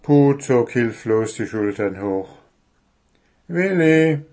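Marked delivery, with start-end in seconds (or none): 3.5-4.2 louder dwarf calling the owl; tentative call. Acted. Dwarf calls the sleeping owl tentatively. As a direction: try calling Willi. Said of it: "versuchte er es".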